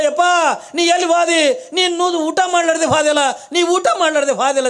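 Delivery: high, loud anguished call after someone gone; tearful calling out. Acted. Woman calling for the vanished holy man, high anguished cry. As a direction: cry out searching, close to tears. She calls out for vanished guest.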